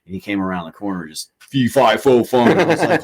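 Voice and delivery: normal talking voice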